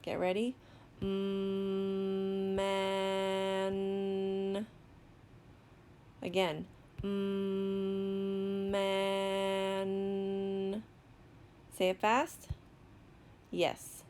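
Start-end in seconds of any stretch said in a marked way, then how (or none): 1.0-4.6 "Mm, man" with each sound held long on one pitch; slow sounding-out. Shown as a demonstration. Teacher sounds out another word, slowly. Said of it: "going to sound out another word". 7.0-10.8 "Mm, man" with each sound held long on one pitch; slow sounding-out. Shown as a demonstration. Teacher sounds out another word, slowly. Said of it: "going to sound out another word".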